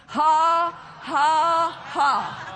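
slow, separate practice ha's, stepping down; demonstrated laugh. Shown as a demonstration. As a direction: lead a group in a deliberate laugh. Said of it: "Can you practice with me?".

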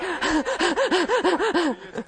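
rapid, breathy stutter of sound; mock rapid-fire noise. Pastor mimicking himself talking a mile a minute, rapid-fire noise. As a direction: imitate your own breakneck talking. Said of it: "I feel like I'm talking a mile a minute".

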